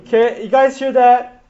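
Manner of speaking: loud, swaggering brag; cocky bragging. Retold or imitated. Peter gloats to the disciples about the keys, loud and cocky. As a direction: show off to the other disciples. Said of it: "he's just feeling like".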